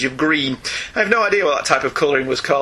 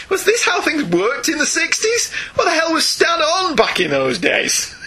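high, slow, exasperated disbelief; mock incredulity; 10 semitones higher than usual. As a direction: read it as a baffled, drawn-out protest. Reads email's baffled rhetorical questions.

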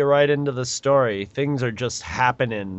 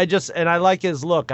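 ordinary delivery

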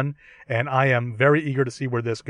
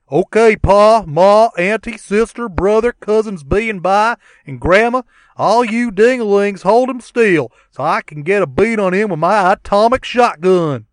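hillbilly voice; 8 semitones higher, 9 dB louder than usual. Acted. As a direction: bark it out like a backwoods boy. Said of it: "we can do a dramatic reading".

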